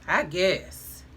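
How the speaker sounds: ordinary delivery, nothing marked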